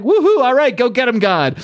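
root for him like a fan at a fight; gleeful ringside cheer. Retold or imitated. Dragon cheering God in fight.

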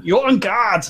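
high mock German radio police; officious comic German accent. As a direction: scold in a put-on German accent. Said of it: "The, the guard police always seems to have a German accent".

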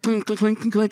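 high, ringing clink noises; quick string of high clinks; imitated clinking. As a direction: mimic a bat clattering down the street. Imitates bat bouncing down street.

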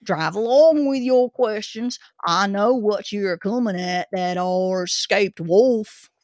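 put-on gruff old Cockney zookeeper; Cockney accent. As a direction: grumble it like a London keeper. Zookeeper character continues.

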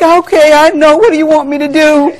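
acting out a put-upon reply; mock defensive mother. Acted exchange with her son.

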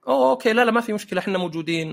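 high-pitched, conciliatory mock reassurance; suddenly placating. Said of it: "هجدت". (موظفة هجدت فجأة، بصوت عالي النبرة ومهادن)

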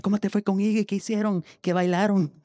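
put-on high excited children's questions; eager kids. Classmates crowd him about the weekend, high and eager. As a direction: pester him like curious classmates.

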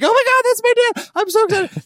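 mock giddy squeal, way up high; squealing excitement. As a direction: squeal it like a giddy fan of her own dad. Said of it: "Amy looks out the window and is like".

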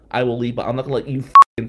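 loud, fast; student standing his ground; angry defiance. Retold or imitated. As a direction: push back hard. Defying the bully.

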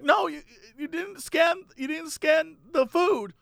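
high, insistent cashier voice; lightly voiced pushy store clerk; older cashier lady. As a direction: pitch it up as the fussy cashier. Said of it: "has a German accent".

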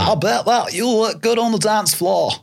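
put-on high, slow singsong Sheffield accent; half-sing it with a Sheffield accent. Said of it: "that Sheffield".

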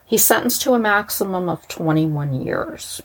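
ordinary delivery

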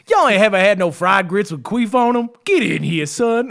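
hammed-up bayou cook calling you in; Southern cook voice. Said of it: "talking like a Aunt Jemima type".

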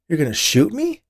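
high, loud, frightened question; alarmed disbelief. A narrator's reading. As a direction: blurt it out in shock. Fears being shot.

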